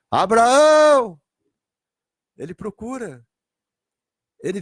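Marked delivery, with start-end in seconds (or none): 0.1-1.2 high, loud, long-drawn call; calling shout. Acted. Jesus calling Abraão in paradise, drawn-out shout. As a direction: call the name out across a great distance. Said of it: "Jesus tá gritando agora".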